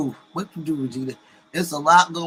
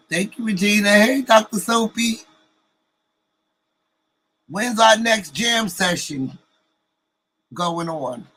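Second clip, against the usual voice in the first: higher and louder, calling out to chat friends; light up and call out each name eagerly; excited greeting; 6 semitones higher, 7 dB louder than usual. Real, not acted. Host greeting her chat friends, higher and louder excited call-out.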